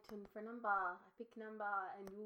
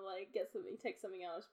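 ordinary delivery

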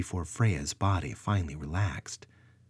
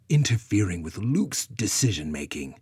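condescending; 3 dB louder than usual. Acted. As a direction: talk down to her. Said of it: "Brona stated condescendingly".

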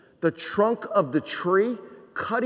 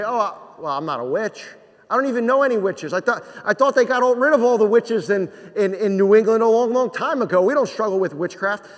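mock objector; 4 semitones higher, 5 dB louder than usual. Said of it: "and you say".

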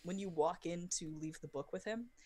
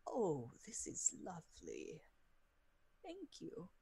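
soft, slow, pleased; delighted character; 2 semitones higher, 5 dB quieter, slower than usual. Acted. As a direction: savor the gift gently. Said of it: "He's like".

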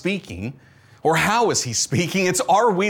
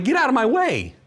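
mildly aggravated, higher-pitched outburst; a touch of exasperation, pitched up; 6 semitones higher than usual. Retold or imitated. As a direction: act out brushing off a pop-up ad, irritated. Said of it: "I get aggravated on my phone".

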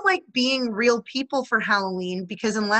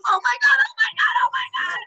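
rising, ever higher shriek; shrieking jackpot winner; 4 dB louder, faster than usual. Real, not acted. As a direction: squeal like you just won big. Shrieking at rooftop scene.

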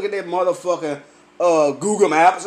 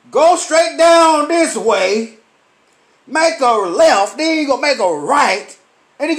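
slow, higher, mocking directions; sarcastic directions; 4 semitones higher than usual. Giving a tourist the long way round, slow and mocking. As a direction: spell it out slowly as if to a child.

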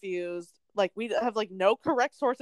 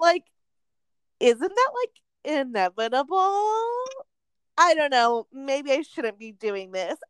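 put-on baby voice; 4 semitones higher, 3 dB louder than usual. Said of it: "like, baby voice".

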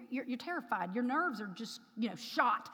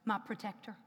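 ordinary talk

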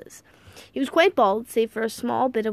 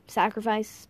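usual speaking voice

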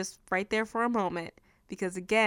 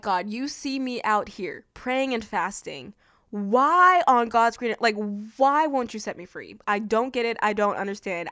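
full-blown attitude. Retold or imitated. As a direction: demand answers with attitude. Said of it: "I had a full-blown attitude".

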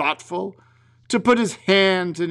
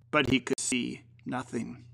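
usual speaking voice

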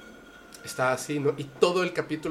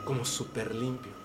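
relieved calm; 7 dB quieter than usual. Retold or imitated. Cliente relajado tras limpia.

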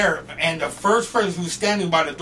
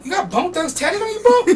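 high, amazed question; surprised; 14 semitones higher, 5 dB louder than usual. Retold or imitated. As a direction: ask in pleased surprise. Roadie amazed by tattoo.

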